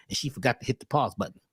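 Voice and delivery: usual speaking voice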